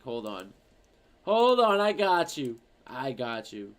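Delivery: ordinary delivery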